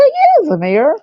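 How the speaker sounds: strange dazed voice. Retold or imitated. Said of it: "But I sounded different".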